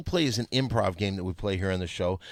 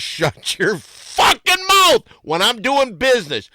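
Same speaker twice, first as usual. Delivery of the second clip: high, loud, slow furious yell; angry shout; 10 semitones higher, 11 dB louder, slower than usual. Acted. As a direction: scream her down in a rage. Pimp yelling at his girl.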